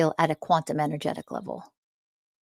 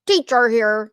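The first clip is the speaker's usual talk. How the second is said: louder, higher boastful guru; lightly voiced arrogant guru; mocking fake teacher; 4 semitones higher, 5 dB louder than usual.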